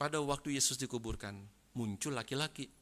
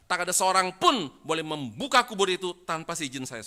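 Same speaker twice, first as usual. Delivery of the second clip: loud, raised-pitch acted decree; forceful official ban, projected loudly; stern prohibition; 6 semitones higher, 11 dB louder than usual. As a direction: lay down the law as governor. Pilate forbidding opening of tomb.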